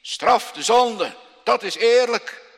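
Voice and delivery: loud, slow, booming cry; a slow thundering shout for punishment. Acted. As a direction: shout the demand to heaven. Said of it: "Maar hij roept niet".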